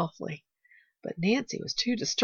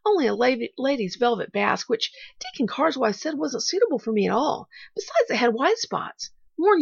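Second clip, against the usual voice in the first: put-on higher-pitched, lively child voice; brave, hurried little girl; 5 semitones higher, 5 dB louder than usual. A narrator's reading. Pollyanna explaining her red gingham dress to Nancy, hurried and brave. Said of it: "she hurried on, with a brave lifting of her head".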